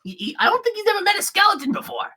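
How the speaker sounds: high, excited squeaky voice; eager little creature. Acted. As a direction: chirp an eager introduction between friends. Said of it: "and goes".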